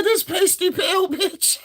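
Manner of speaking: high, drawn-out quote through laughter; laughing imitation. Telling what his date said, cracking up. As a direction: repeat her insult slowly, squeaking with amusement. Repeating her insult while cracking up.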